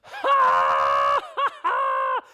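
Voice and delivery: loud, high mocking ha ha ha; hammed-up shrieking laughter; imitating her big laugh. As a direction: cackle it out like she's doubled over. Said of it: "bent over laughing".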